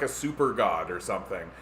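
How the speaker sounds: ordinary talk, nothing marked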